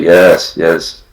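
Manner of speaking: usual speaking voice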